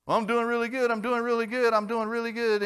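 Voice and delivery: high, loud, breezy bragging; sing-song self-assurance, a little smug; mock complacent. Believer boasting he's fine before the storm, high and breezy.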